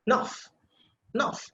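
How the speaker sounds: "Nuff" for "enough", starting straight on the N; first vowel dropped. Shown as a demonstration. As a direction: say "enough" as "Nuff", opening vowel almost silent. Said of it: "it will be pronounced just like this".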